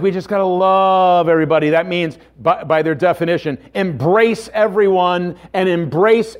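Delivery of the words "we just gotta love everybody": quick mock slogan; imitating the world. Preacher mimics the world's idea of love, lightly mocking. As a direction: toss it off as the world's easy slogan. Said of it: "The world says".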